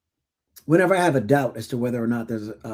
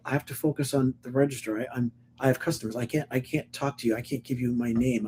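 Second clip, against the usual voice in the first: anxious quiet cashier; 7 dB quieter than usual. Retold or imitated. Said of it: "the guy's like".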